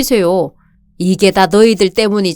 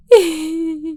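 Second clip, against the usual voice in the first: a high, soft sob breaking out; crying sob; 7 semitones higher, 4 dB quieter than usual. Acted. Mother sobbing as her daughter-in-law comforts her, high soft sob. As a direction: let a sob escape. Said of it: "울지 마세요".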